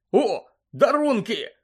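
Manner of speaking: high, loud, drawn-out delight; delighted exclamation. A narrator's reading. Boy noticing Christmas parcels by his bed, excited. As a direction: cry out on spotting presents. Roommate spots the Christmas presents.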